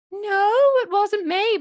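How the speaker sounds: high, loud, drawn-out mock denial; child denying. Guilty child answering the parent, high overdone denial. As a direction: protest innocence in a high child's voice. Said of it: "And the child goes".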